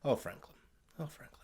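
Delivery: soft, low, pitying sigh of a name; tender, barely spoken pity; sorrowful hush. Retold or imitated. Death voiced gently, sorrowful.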